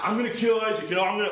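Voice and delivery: high-pitched mock rant as an enraged brother; freaking out. Cheated brother vows revenge to anyone listening, freaking out. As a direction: act out a furious, high-voiced threat. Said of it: "he's just freaking out".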